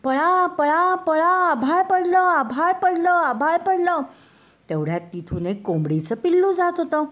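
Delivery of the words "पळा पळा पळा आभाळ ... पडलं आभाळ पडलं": high, loud frantic rabbit cry; panicked shouting. Acted. Scared rabbit screaming to the whole forest, high panicked shout. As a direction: yell it like the sky is really falling. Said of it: "जोर जोरात ओरडत सुटला".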